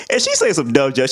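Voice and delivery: ordinary talk, nothing marked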